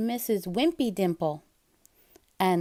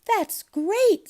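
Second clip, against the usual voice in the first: much higher, louder delighted mom; Mom's voice; 11 semitones higher, 6 dB louder than usual. Acted. Mom praising Splat's plan, delighted. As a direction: beam with approval. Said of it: "she said".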